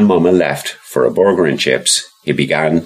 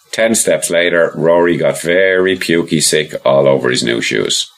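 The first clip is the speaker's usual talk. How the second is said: plain reading voice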